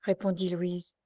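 plain reading voice

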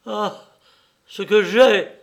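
high, very loud cry; exclaimed outburst. A narrator's reading. Son nearly blurts his secret to mother, crying out. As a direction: burst out, rising to a shout. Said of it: "s'écria-t-il".

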